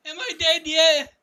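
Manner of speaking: high, loud, hammed-up dying wail; dying character voice.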